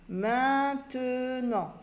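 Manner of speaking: Maintenant stretched very slowly; drawn-out word. Shown as a demonstration. Teacher to pupils, drawing out the finished word. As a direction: sound out Maintenant syllable by syllable. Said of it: "Et on a ici tenant main".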